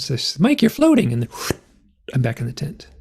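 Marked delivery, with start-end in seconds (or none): 0.4-1.1 high-pitched, louder imitation of a German accent; Natasha's German accent. Camper imitating tentmate calling to him, German accent. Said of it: "I hear with her German accent".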